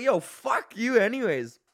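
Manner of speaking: high, slow, drawn-out cursing; mock outrage. Student retelling his comeback to the IT guy, mock outrage. Acts angry reply to IT guy.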